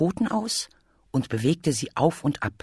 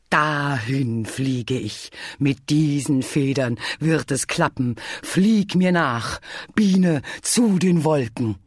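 triumphant proclamation. Acted. Bear summons Bee to the clouds, exultant. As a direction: proclaim it grandly, rising to a call.

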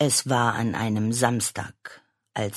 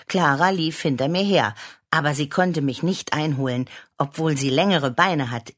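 usual speaking voice

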